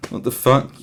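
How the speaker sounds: usual speaking voice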